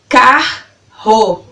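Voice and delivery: CARRO slowly split into car - ro; syllable by syllable. Shown as a demonstration. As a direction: pause between the two beats of CARRO. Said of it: "falando elas pausadamente pra você".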